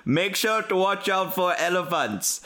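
put-on radio call in a light African accent. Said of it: "Simba One, on the radio going like".

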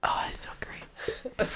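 slow breathy creepy whisper. Real, not acted. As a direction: draw it out slow and eerie. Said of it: "That was creepy".

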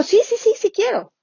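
high, eager repeated yeses. Acted. Patient eagerly accepting the nurse's offer of the window bed, high and eager. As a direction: jump at the offer, voice way up.

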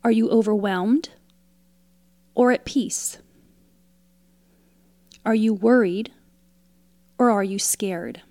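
usual speaking voice

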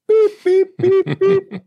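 high loud mouth bleeps; censor bleeps. Retold or imitated. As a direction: sing the censor beeps out loud. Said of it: "Push the button, man".

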